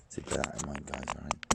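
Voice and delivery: ordinary talk, nothing marked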